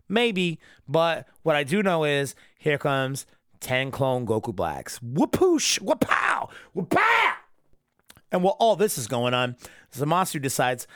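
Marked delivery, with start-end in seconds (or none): 5.0-7.3 high-pitched vocal explosion noises; imitated whooshing. Ten clone Goku Blacks burst in, high imitated whooshes.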